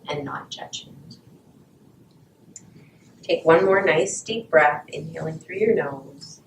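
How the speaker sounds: soft, calm breathing guidance; guided meditation. Acted. Closes meditation, eyes opening.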